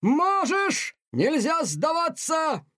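very high, slow, forceful urging; insisting colonel. Acted. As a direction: drive the words home, slow and high. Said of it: "настаивал полковник".

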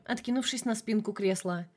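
usual speaking voice

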